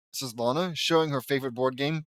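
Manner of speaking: ordinary delivery, nothing marked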